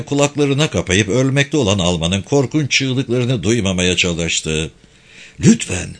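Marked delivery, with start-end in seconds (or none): 5.4-5.9 mildly urgent begging; pleading aloud. Acted. Mark begging the dying German to stop, pleading aloud. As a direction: beg aloud with restrained desperation. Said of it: "diye yalvardı Mark yüksek sesle".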